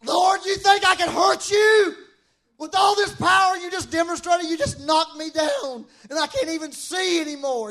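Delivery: high, hammed-up wailing protest; protesting Paul. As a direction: plead in disbelief, loud at first then easing. Comic acting of Paul protesting to God.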